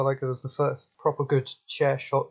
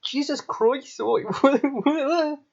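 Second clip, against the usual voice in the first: high-pitched, hammed-up Scottish impression; mock Scot in exaggerated high-voiced disbelief; bad Scottish accent; 11 semitones higher, slower than usual. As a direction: do a terrible, squawky Scottish accent. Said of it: "let's do an impression of each other's accents".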